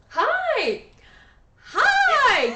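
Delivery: very high, squeaky greeting; a man with a startlingly high voice; squeaky hi from a high-voiced guy; high-pitched guy voice. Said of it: "Hey, how you doing?".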